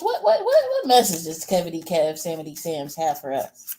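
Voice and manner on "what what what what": much higher, rising squeal on each what; squeaky excited stammer. Real, not acted. Reader squeals over Kevity Kev nickname, high excited stammer.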